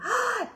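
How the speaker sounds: sharp high gasp of thrill; excited gasp. Acted. Reacting to snowmen sledding, excited gasp. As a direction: gasp at the wild ride.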